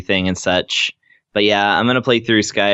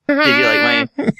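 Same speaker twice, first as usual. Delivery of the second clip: noisemaker impression. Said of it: "that's my impression of a noisemaker".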